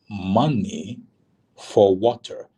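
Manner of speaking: spaced out, with a pause after "Money"; "Money", pause, then "for water" pushed slightly harder; slow, deliberate repeat. Retold or imitated. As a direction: imitate someone spelling it out for a confused listener. Soldier repeats demand after "Wait, what?.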